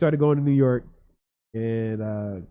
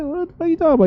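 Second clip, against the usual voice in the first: much higher, puzzled; confused self; 6 dB louder, much faster than usual. Retold or imitated. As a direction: act out his hungover confusion. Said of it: "I was like".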